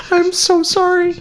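high-pitched little apology; squeaked apology. Real, not acted. Host apologizing after joke outburst, high squeak.

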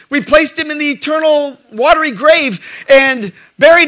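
loud, high storytelling from the pulpit; full-voiced preaching. Real, not acted. Preacher recounting a goldfish funeral, proclaimed loudly.

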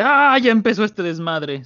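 drawn-out high "Ah", easing down as it goes; amused onlooker. Retold or imitated. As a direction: stretch it out like a delighted heckler, no real laugh. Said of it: "Sarkis se ríe".

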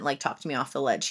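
normal talking voice

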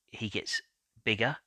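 ordinary talk, nothing marked

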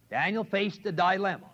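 usual speaking voice

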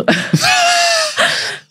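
high, squealing burst of laughter; helpless high-pitched laughing fit; shrieking laugh. Real, not acted. Burst after retold punchline.

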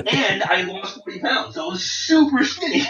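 high, loud, through real laughter; amused, voice climbing with laughter; laughing. Real, not acted. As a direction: tell it while cracking up. Laughing at his own skinny look.

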